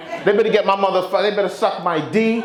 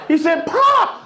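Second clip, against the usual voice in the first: high cork pop; pop sound; 13 semitones higher, slower than usual. Retold or imitated.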